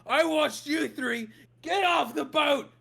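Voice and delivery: high, loud furious shouting; angry guard. Acted. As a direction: accuse them with rage. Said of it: "He's like".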